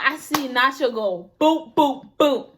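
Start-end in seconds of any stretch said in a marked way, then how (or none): 1.4-2.5 punchy boop sound effects, louder; imitated punch sounds. Telling a friend about a fight, imitated punch sounds. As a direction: pop out little punch noises.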